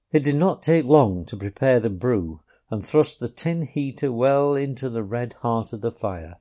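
plain reading voice

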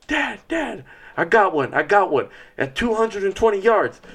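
put-on high, excited kid voice calling out. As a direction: play the boy thrilled about his first buck. Son calling with the news.

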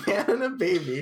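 laughing. Real, not acted. Laughing while rereading.

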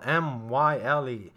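letters "M Y L E" spoken one by one; spelling it out. Shown as a demonstration. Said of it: "it's spelled".

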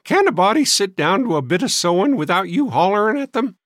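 high, loud cracked complaint; querulous old maid. A narrator's reading. Sister interrupted at her sewing, querulous. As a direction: scold in a high, fussy voice.

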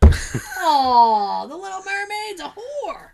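hammed-up fake wail; mock crying. Hammed grief over the Ariel gossip.

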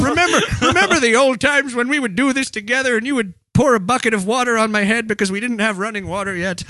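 high, hammed-up elderly reminiscing; old spouse fondly recalling, comically frail; feeble old person. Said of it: "sad old feeble couple".

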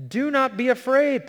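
high, loud angelic command. Acted. The angel commanding Paul, high and loud. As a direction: call out boldly as the angel.

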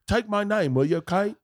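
high-pitched comic Australian husband; mock Australian accent. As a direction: put on a hammy Australian accent. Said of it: "he's like".

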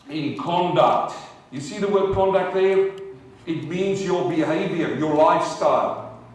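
loud, fiery preaching to the congregation; full-force preaching. Acted. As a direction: thunder it out from the pulpit.

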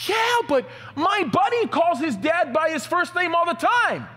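high, slow, loud whiny protest; protesting kid. Retold or imitated. Son argues back to his strict father, high loud whine. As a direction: shout it back like a kid defending himself.

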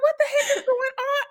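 high, fast, laughing repeat; laughing shock. Retold or imitated. Retelling her reaction, high laughing disbelief. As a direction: squeal it again through giggles. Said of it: "I was like".

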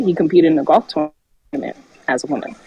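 ordinary talk

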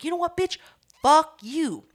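high, loud, emphatic curse; acting out a heated retort; defiant comeback. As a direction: wind up, then hit back harder. Own comeback in acted quarrel.

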